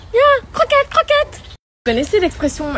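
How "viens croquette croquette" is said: high sing-song call to a cat; high coaxing pet call. Real, not acted. Calling the cat down.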